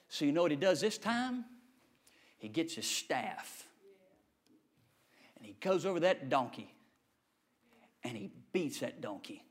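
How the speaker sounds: low, soft preacher telling the tale; hushed sermon storytelling. Acted. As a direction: drop right down and draw the congregation in.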